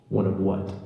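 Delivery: low, deadpan clerk's question; puzzled clerk. Retold or imitated. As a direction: lightly voice a baffled, low-pitched clerk.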